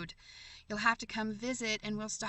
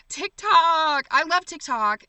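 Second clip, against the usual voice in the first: excited exclaim; 7 semitones higher, 12 dB louder, slower than usual. Real, not acted. Viewer mentions TikTok.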